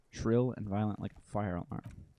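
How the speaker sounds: usual speaking voice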